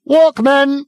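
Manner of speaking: high, loud sudden shout. Acted. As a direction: distract the crowd with a yell. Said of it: "he shouted".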